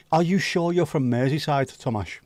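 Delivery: normal talking voice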